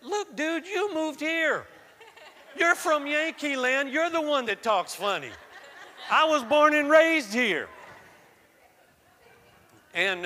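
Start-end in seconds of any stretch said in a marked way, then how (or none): 0.0-7.7 put-on teenage Southern twang, pitched well up and loud; indignant Southern teen talking back; Southern accent. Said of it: "I'm saying to them".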